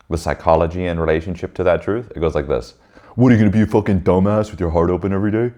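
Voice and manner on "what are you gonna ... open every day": lightly voiced scornful inner ego; scoffing ego. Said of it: "You know what your ego says?".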